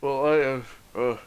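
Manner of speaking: king voice. Acted. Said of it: "And he says".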